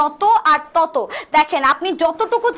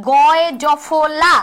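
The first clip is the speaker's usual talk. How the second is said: slow, stretched Gaye জফলা; spelling it out; much slower than usual. Shown as a demonstration. Teacher showing the spelling behind the sound, slowly spelling out Gaye জফলা. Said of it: "এটার উচ্চারণ কি?".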